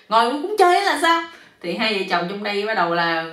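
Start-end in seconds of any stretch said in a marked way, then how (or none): 0.1-1.2 high, loud indignant question; exasperated yell. A narrator's reading. Man bitten while sitting, exasperated yell. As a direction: shout it, fed up and outraged. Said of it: "ảnh la lên tiếp".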